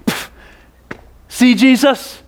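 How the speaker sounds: scoffing, dismissive mutter; a grumbling, put-out sneer. Retold or imitated. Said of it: "With grumbling hearts towards Jesus".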